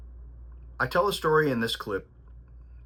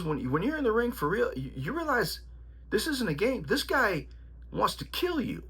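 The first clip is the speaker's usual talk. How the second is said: put-on much higher, stern old trainer voice; imitating his trainer; 9 semitones higher than usual. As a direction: channel the old-school trainer laying down the law. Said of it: "He goes".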